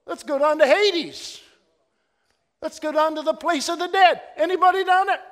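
loud, high preaching build; full-force preaching. Real, not acted. Preacher calling out to the congregation, loud and high. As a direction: cry it out from the pulpit.

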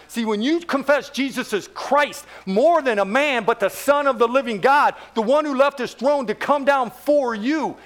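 ordinary talk

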